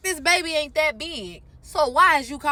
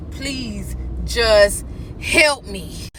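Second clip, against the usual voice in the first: slow, lower, drawn-out plea; pleading; 4 semitones lower, much slower than usual. Retold or imitated. Woman in labor begging the medics, slow pleading. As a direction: beg weakly for help. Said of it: "So I'm pleading for help".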